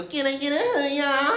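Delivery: high, slow hyped-up prankster call; prankster kid. Retold or imitated. Imagined teen prankster shouting at the camera, high hyped call. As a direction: ham up a teen YouTuber begging the crowd. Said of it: "he was gonna go".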